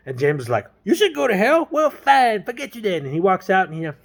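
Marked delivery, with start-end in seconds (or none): 0.8-3.1 high, indignant retort; hammed-up offence, pitched way up; mock huffy anger. James storms back at the girl, mock huffy.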